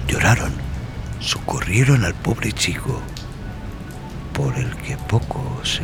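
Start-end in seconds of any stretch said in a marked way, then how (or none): none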